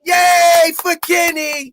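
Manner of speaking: high, slow mock celebration; sarcastic cheer. Cheering after a friend's public insult, sarcastic high cheer. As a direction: stretch the yay in fake triumph.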